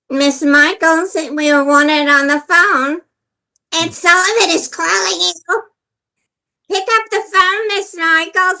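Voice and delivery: page her like a hotel loudspeaker; mock PA announcement. Loudspeaker paging her name.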